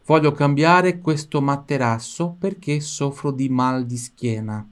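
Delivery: slow, careful Italian for the learner; slow model sentence. Shown as a demonstration. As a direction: spell out each word slowly. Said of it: "Repita a frase inteira em voz alta". (frase italiana lenta e clara)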